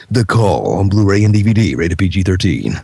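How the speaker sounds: deep action trailer announcer read; movie trailer voice. Shown as a demonstration. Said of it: "the one that I did recently".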